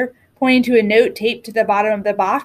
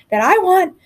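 breathless, gasping for air; panting between words; out of breath; 4 semitones higher than usual. A narrator's reading. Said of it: "in between gasps of air".

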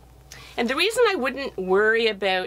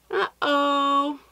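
higher, caught-out uh-oh; character uh-oh; 5 semitones higher than usual. Acted. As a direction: sound like you just got caught. Character reacts to trouble.

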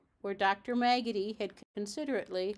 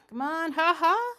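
high, loud squawking laugh of a magpie; loud mocking magpie call; magpie's cackling cry; 6 semitones higher, 6 dB louder than usual. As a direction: cackle it out like a cheeky bird. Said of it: "said the doctor".

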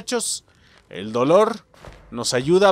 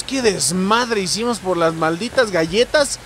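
normal talking voice

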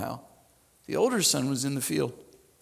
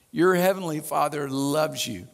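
ordinary delivery

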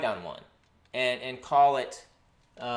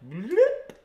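cartoon bloop sound; 13 semitones higher, 5 dB louder than usual. Imitated sound.

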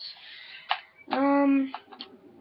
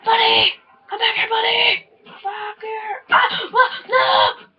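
high, furious shouting at the screen; raging; 12 semitones higher, 4 dB louder, slower than usual. Real, not acted. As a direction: scream in full gamer rage. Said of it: "as soon as I finish raging".

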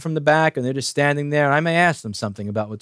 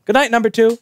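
teasing farewell; 9 semitones higher, 6 dB louder than usual. Retold or imitated. Retold teasing call on leaving.

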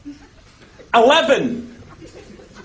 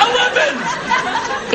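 stretched yell; 9 semitones higher, much slower than usual. Acted. Furious shout at lift.

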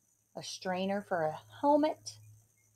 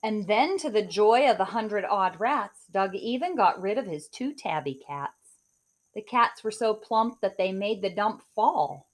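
put-on lively rhyming read-aloud for kids; story narration; 3 semitones higher, 7 dB louder than usual. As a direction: read it like a bedtime picture book. Reads picture book aloud.

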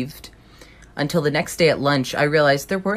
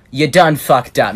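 defiant; 3 semitones higher, 8 dB louder, slower than usual. Retold or imitated. Defiant inner retort at principal.

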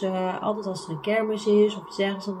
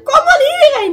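much higher, louder call across the house; mother calling back; 15 dB louder than usual. Retold or imitated. Mother calls her frightened daughter to her room, raised call. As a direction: mum shouting come here. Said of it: "ze zegt".

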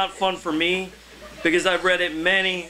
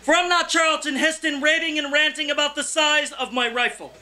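higher, measured open-mic poem delivery; poetry reading; 8 semitones higher than usual. Acted. Poet reading his poem to an open mic crowd, declaiming. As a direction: read it to the room like a performance poem.

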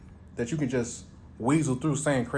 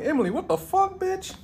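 shrieking much higher in disbelief; high-pitched shocked comeback; shocked outrage; 9 semitones higher than usual. Retold or imitated. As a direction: squeal out the outrage. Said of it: "I'm like".

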